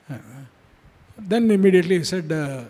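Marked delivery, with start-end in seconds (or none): none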